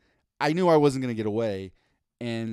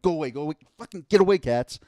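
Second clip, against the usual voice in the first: louder, higher shoo rising into a shout; shooing cats; 3 semitones higher, 6 dB louder, faster than usual. Retold or imitated. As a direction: chase the cats off.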